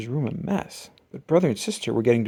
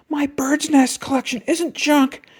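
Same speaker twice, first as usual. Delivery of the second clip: higher slow protest, lightly voiced yell; Brother yelling; 12 semitones higher than usual. A narrator's reading. Brother protesting to Mama, mild yell. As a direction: defend the collection, upset. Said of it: "yelled Brother at the top of his lungs".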